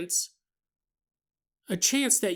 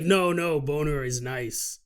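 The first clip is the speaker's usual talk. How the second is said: gentle monster, softly and low; Bigfoot; 6 semitones lower, 3 dB quieter than usual. Acted. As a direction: reassure as a simple creature, pitched down. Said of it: "He's like".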